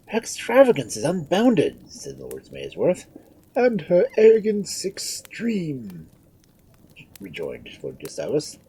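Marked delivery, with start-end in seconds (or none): 0.0-1.9 high, loud lordly complaint; scandalised peer; indignant lord. A narrator's reading. Lords complain about the Queen. 3.5-6.1 high, slow, loud rejoinder; puffed-up peer agreeing; pompous lord. A narrator's reading. Lord joins in the complaint.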